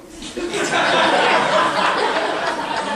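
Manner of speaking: big hammy laugh. Acted. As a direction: burst out laughing. Loud laugh.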